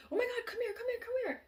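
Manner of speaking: high, alarmed calling of the dog's name; frightened owner crying out for her pet; freaking out. Retold or imitated. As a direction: panic as you call for your hurt dog. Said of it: "like, freaking out".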